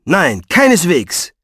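slow, irritated rebuttal; a touch of temper; snappish denial. A narrator's reading. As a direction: reject it testily, word by word. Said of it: "fuhr er sie an".

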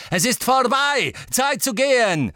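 high, urgent shout; calling out. A narrator's reading. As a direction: shout the order over the noise of battle. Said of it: "hörte er die verhasste Stimme rufen".

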